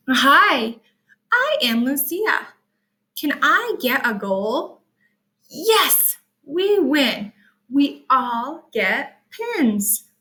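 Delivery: storybook read-aloud; reading to children. Acted. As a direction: read it brightly for little listeners.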